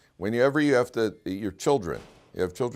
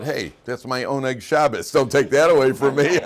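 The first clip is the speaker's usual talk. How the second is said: hammed-up protest, louder at the end; mock indignant; 5 semitones higher, 8 dB louder than usual. Rabbi answering a question about Shabbos, mock indignant. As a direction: playfully defend your treat, building to loud. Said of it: "I said".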